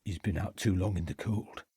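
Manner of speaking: hushed aside to the others; whispered. A narrator's reading. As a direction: keep it from the boy. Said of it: "whispered Catherwood".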